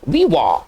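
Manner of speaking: वी वॉ as "vee-waw", final t silent; वी वॉ in two clear syllables; French pronunciation demo. Shown as a demonstration. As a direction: say वी वॉ with an open ending. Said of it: "ये जो silent हो जाएगा".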